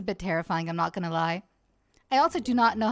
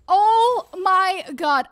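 high, loud, stretched out; shaken exclamation; 6 semitones higher, 9 dB louder, much slower than usual. Real, not acted. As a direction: wail it slowly and loudly. After near death.

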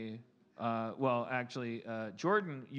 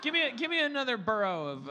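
ordinary talk

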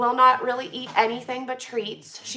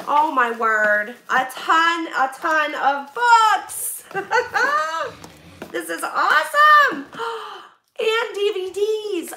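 thrilled exclamations, louder, with a laugh in the middle; excited; 5 semitones higher, 5 dB louder than usual. Real, not acted. Opening a donor's box on camera, excited and laughing. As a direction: gush over the books in the box.

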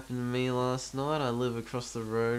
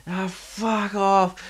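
high, slow slurred brush-off; drunk dismissal; 8 semitones higher, 5 dB louder, much slower than usual. Retold or imitated. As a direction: drag it out like a drunk. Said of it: "And he's like".

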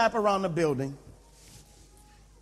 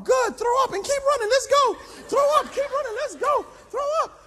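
high, loud coach yell; trainer's voice; 5 dB louder than usual. Acted. Trainer yelling at the nauseous pastor to keep running, high and loud. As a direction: drive them on mercilessly, loud and high. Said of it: "Milton, I gotta throw up".

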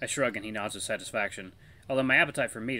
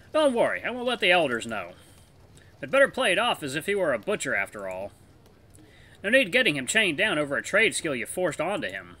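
put-on higher, genial older wolf voice; friendly older wolf; 5 semitones higher, 6 dB louder than usual. Elder wolf offering to take the pup as apprentice, genial and higher. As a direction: play the kindly elder wolf teasing his friend. Voices Vithir in visual novel.

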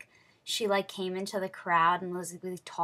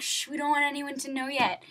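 high, urgent retold shushing; raised-pitch mock shush; shushing guy; 7 semitones higher than usual. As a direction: imitate a staffer hushing an excited fan. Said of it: "And the guy's like".

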